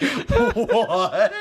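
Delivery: high, drawn-out incredulous squeal; laughing disbelief. Retold or imitated. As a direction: blurt it high through laughter. Incredulous reaction amid laughter.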